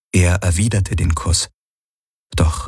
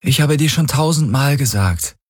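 higher-pitched, mildly tired reproach; weary retort; 8 semitones higher than usual. A narrator's reading. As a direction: answer back with a touch of weariness. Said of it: "gab sie müde zurück". (müde, leicht genervte Erwiderung)

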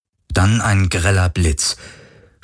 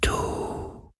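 very slow, breath-only whisper, words drawn far apart; faint ghostly whisper; 10 dB quieter, much slower than usual. A narrator's reading. The wind asks Galen who he is, faintest whisper. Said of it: "so leise wie der Schatten des Hauchs eines Flüsterns".

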